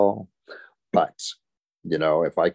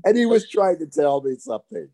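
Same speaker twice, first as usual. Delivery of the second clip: laughing; 13 semitones higher, 3 dB louder than usual. Real, not acted. Laughing confusion retold.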